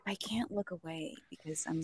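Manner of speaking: hushed whisper; breathy whispered aside. Retold or imitated. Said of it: "they're like".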